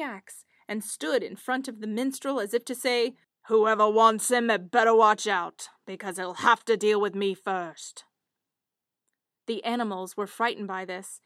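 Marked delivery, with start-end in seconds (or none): 3.5-8.0 woodcutter warning the beasts. Acted.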